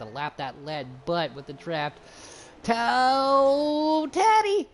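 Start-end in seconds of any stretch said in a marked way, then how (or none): 2.6-4.7 long, high holler on the name; loud, high announcer hype; excited race call. Real, not acted. Commentator hailing Aqua Teddy's charge, excited holler.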